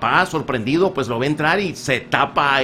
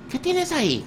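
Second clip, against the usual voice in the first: put-on child voice; 13 semitones higher, 6 dB quieter than usual. Pepito voiced in a joke.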